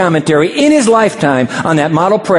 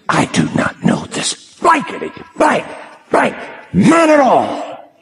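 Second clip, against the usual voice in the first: cursing denial; 8 semitones higher than usual. Pretend. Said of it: "Peter will curse the name".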